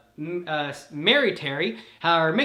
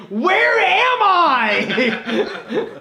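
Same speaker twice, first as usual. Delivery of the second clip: high, very slow, laughing confusion; laughing dazed question; 11 semitones higher, 7 dB louder, much slower than usual. Acted. Liwa waking in a new body, laughing and bewildered. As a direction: stretch it out while cracking up.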